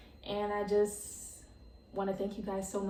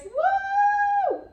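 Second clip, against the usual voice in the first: high, loud, drawn-out woo; celebratory whoop; 10 dB louder than usual. Real, not acted. Toasting listeners to the podcast milestone, celebratory whoop. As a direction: let out a long cheering whoop.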